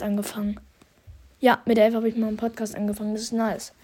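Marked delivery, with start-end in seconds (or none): none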